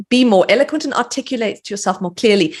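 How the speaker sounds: normal talking voice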